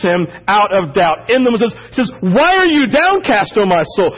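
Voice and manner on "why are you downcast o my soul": higher, insistent, DON'T stressed each time; firm self-rebuke, urging; rebuking himself. Retold or imitated. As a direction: shake your own soul awake. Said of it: "rebukes David out of despair".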